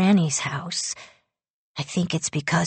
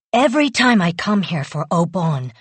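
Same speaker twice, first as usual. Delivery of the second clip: ordinary delivery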